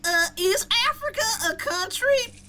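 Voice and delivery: high, clueless mock question; mocking dumb girl. Mocking a street interview girl, high and clueless. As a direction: play the airhead from the street interview. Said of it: "They'd be the same people who will probably say".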